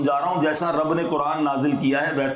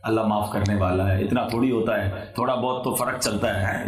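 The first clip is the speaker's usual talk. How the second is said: lower, lightly voiced careless layman; people excusing themselves; 7 semitones lower than usual. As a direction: mimic the casual excuse-maker, lower. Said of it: "لوگ کہتے ہیں". (بے پروا لوگوں کی ہلکی نقل)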